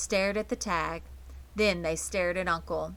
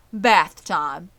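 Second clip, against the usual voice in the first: cheerful; 9 dB louder than usual. A narrator's reading. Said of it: "said Zuckerman cheerfully".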